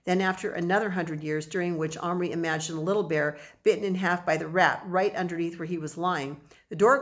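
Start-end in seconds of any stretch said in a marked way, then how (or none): none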